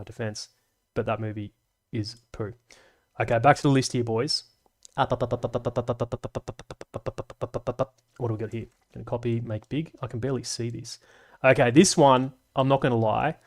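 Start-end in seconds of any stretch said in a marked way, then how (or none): none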